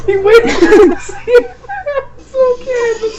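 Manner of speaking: words squeezed out through laughter; high-pitched laughing speech; laughing while talking. Real, not acted. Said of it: "trying not to laugh".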